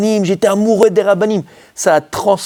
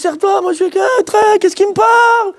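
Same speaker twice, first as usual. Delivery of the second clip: much higher, slow, timid squeak; mock panic. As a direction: squeak like a terrified shy boy. Panicked shy boy reaction.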